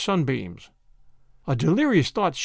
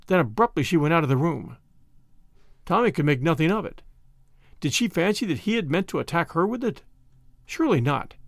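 plain reading voice

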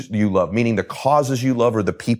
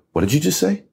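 shocked disbelief; 8 semitones higher than usual. Retold or imitated. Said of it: "And I went".

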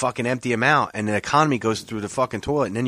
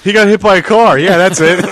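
much higher, much louder, through laughter; mock the sob story while cracking up; laughing mockery; 12 semitones higher, 14 dB louder than usual. Real, not acted. Mocking a dog scam story.

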